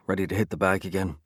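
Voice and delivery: ordinary delivery, nothing marked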